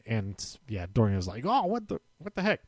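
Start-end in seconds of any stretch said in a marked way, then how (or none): 1.5-2.6 high, soft mock protest; small high-pitched voice of disbelief; mock dismay. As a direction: imitate a baffled loser, pitched up and quieter. Acted reaction of the beaten wrestler.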